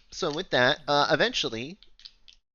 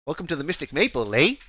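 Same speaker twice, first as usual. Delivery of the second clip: higher, quick Canadian shop greeting; Canadian accent; 4 semitones higher, 7 dB louder than usual. Acted. Elf shopkeeper welcomes customers, Canadian accent. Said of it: "half of the elves are Canadian".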